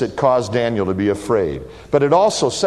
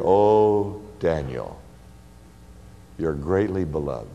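much softer and lower, lingering; tender; 8 semitones lower, 4 dB quieter than usual. Acted. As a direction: say it as a loving caress. Christ's tender words to Daniel.

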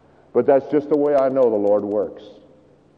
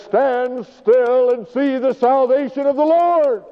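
much higher, slow, booming preacher proclamation; grand preacher Moses; 9 semitones higher, slower than usual. Retold or imitated. Moses proclaiming to the frightened Israelites like a preacher. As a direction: ham up a pulpit pronouncement, drawn out. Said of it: "Moses stands up like any good preacher would and says".

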